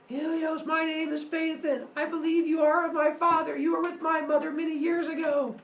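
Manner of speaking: put-on high-pitched, earnest little-boy voice; child yelling out. Boy announcing himself to his father, high child's call. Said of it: "And he yells out".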